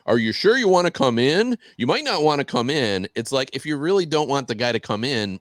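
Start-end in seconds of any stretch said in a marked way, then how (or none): 0.1-3.1 a mock-ominous warning, much higher and louder; eerie warning. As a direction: warn the guest playfully, in a spooky voice. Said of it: "she's like".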